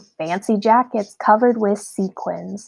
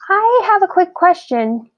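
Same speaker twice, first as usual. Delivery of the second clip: high squeaky little voice; 9 semitones higher, 4 dB louder than usual. A narrator's reading. Curious Quentin squeaks his question to Queenie. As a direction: squeak it out nervously. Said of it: "he squeaked".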